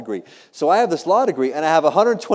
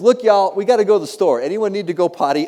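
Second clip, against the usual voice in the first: put-on louder, higher call to children; parent rounding up kids; 5 semitones higher, 4 dB louder than usual. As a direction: corral the little ones before an outing. Voices himself asking his kids.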